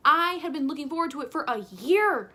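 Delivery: dramatic, indignant emphasis through to "year"; emphatic protest. Real, not acted. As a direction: push "year" up high and loud. Defends her dramatic disappointment.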